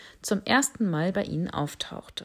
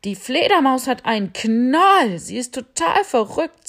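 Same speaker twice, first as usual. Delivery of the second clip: much louder, higher, worked-up shout; excited little elephant; 6 semitones higher, 9 dB louder than usual. Acted. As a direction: blurt it out to the owl. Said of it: "sagte der kleine Elefant". (aufgeregter kleiner Elefant)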